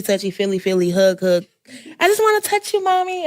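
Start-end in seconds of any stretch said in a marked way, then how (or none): 2.0-3.2 put-on high little child's voice, pleading. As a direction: play the clingy little kid begging for a hug, much higher. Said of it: "my youngest is touchy".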